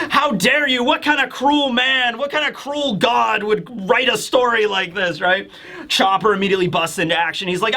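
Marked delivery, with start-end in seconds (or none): 0.1-5.2 high, hammed-up indignation; high-pitched acted protest; mock outrage. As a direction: rail at a cruel author. Sanji's mock outrage at author.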